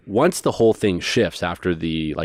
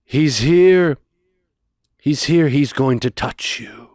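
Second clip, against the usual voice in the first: imitated preacher; 4 semitones higher, slower than usual. Preacher uncle telling the crowd the healer is here. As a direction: make them feel a presence arriving. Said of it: "and he goes".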